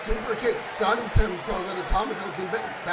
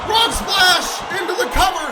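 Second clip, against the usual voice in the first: excited; 6 semitones higher, 6 dB louder than usual. Real, not acted. Commentator calls finishing move.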